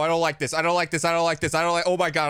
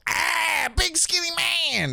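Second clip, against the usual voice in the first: high, slow, stretched scared yell; mock scream; slower than usual. Gamer retells yelling at a monster near dad's student. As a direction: wail it like you've seen the monster. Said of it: "they heard me go".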